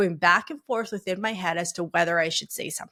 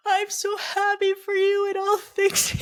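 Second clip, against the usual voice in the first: very high, slow tearful squeal; hammed-up crying voice, stretched and high; mock bawling; 13 semitones higher, 3 dB louder, slower than usual. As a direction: imitate bawling through happy words. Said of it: "I'm bawling".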